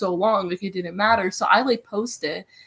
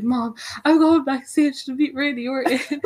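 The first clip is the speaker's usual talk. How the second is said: excited text to mom; 5 semitones higher, 4 dB louder than usual. Retold or imitated. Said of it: "So I'm like".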